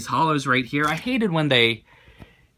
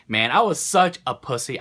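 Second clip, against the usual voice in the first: ordinary talk, nothing marked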